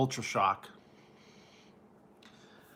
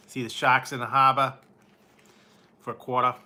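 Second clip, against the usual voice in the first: louder hammed-up Boston "hahbah" and "quahtah"; hammed-up Boston Maine accent; mock Boston accent; 4 dB louder than usual. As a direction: play a New England local for laughs. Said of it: "stereotypes that they like to say".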